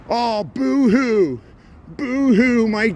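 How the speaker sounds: slow, high, sarcastic boo-hoo; mock crying. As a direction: fake sobbing to mock him. Mocking a politician's complaint.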